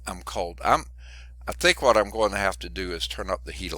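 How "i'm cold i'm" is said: ordinary talk, nothing marked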